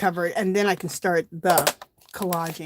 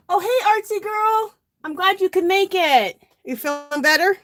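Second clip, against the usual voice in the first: bright high-pitched hello; cheery greeting; 9 semitones higher than usual. Real, not acted. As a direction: greet a friend warmly and brightly. Greeting a viewer on livestream.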